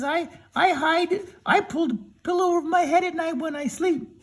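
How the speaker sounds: storyteller voicing a high, timid cowardly lion. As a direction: beg way up high as the scared lion.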